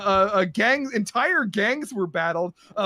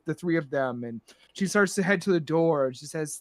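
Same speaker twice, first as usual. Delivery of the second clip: ordinary talk, nothing marked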